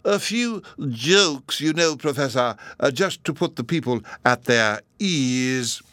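plain reading voice

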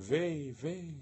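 high-pitched mock wail, sung out. Freya runs on wailing, high mock cry. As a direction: wail like a frightened goddess, lightly.

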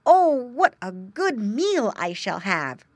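high, loud, slow piglet savouring; a piglet dreaming of dinner; slow high greedy piglet; greedy piglet grunt. A narrator's reading. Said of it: "grunted Squinty".

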